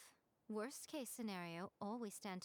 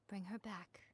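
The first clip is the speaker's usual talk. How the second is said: whispered plea. Acted. Heartfelt final plea.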